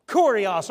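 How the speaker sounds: Kurios said slowly and firmly as KOR-ee-os; Greek word repeated. Shown as a demonstration. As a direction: stress the first syllable of Kurios. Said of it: "In the Greek".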